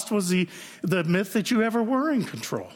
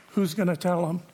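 ordinary talk

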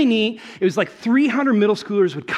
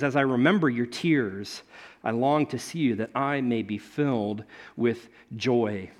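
tender reading; 6 semitones lower than usual. Acted. Reading Paul's tender letter aloud.

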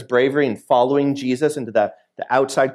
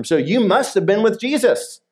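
higher, lightly acted accusation; insistent accusation; 7 semitones higher than usual. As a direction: press the point as an accuser. Voices bystander accusing Peter.

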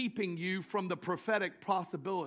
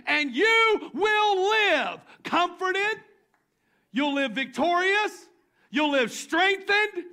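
booming preached voice of God, higher and louder; 8 semitones higher, 8 dB louder, slower than usual. Acted. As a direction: proclaim it as God lifting them up. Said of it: "and God says".

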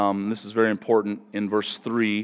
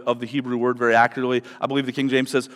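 usual speaking voice

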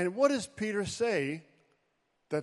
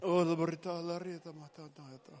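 speaking in tongues; 5 dB quieter, faster than usual. Real, not acted. Speaking in tongues after long pause.

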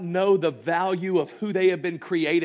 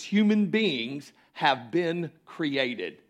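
usual speaking voice

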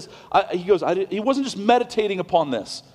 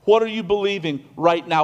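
ordinary delivery

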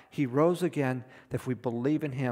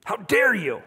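hammed-up indignant protest, higher and louder on the second; mock angry outburst; 4 semitones higher, 5 dB louder than usual. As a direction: pretend to snap back at the doctor. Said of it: "get mad at the doctor and say".

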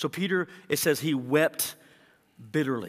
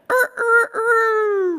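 loud, high-pitched imitation rooster crow; 14 dB louder, much slower than usual. Preacher acting out the rooster at Peter's denial, loud crowing. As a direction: crow like a rooster, last note held long.

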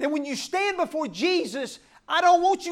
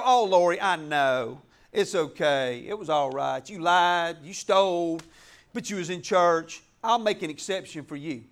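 lenient Jesus; 9 semitones lower than usual. Retold or imitated. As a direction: play a pushover judge letting her off easy. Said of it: "He ain't gonna change his mind and go".

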